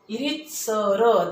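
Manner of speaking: रितसर slowly, with the ree lengthened; रितसर drawn out slowly with a long ee; long drawn-out vowel. Shown as a demonstration. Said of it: "दीर्घ उच्चार झाला".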